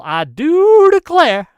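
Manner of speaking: loud, high-swooping mock Southern drawl on "I do declare"; exaggerated Southern-belle accent, badly faked; attempted Southern accent. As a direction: ham up a bad Southern accent, loudly. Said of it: "Can't even do the accent right".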